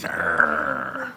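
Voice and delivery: low, gruff playful arrgh; pirate growl. Pretend. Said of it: "Oh, funny noises".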